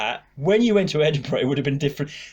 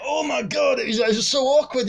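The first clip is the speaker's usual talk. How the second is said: high, exclaiming impression of a Scottish man; retold, high-pitched horrified gossip; imitated Scottish bloke; 8 semitones higher than usual. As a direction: exclaim as the aghast Scottish onlooker. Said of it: "So the Scottish guy's like".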